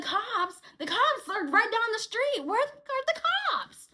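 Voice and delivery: high-pitched, urgent retelling; agitated coworker. Retold or imitated. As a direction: act out a worked-up coworker, stretching the last question. Acting out coworker asking for cops.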